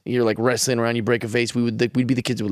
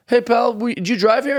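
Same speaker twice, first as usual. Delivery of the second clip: higher, lightly voiced cop; imitating the cop; 9 semitones higher than usual. Imitating the cop who questioned him at the coffee shop. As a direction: lightly play a suspicious cop. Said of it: "this cop's like".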